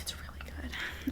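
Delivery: hushed whisper about a favorite drink. Real, not acted. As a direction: whisper it like a secret. Confiding about her favorite drink.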